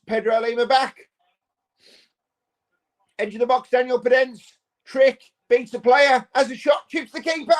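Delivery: rising, shouted play-by-play; excited commentary. Real, not acted. Fan calling the match to the stream, getting excited. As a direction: call the chance as it builds to a yell. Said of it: "Sorry, I got excited".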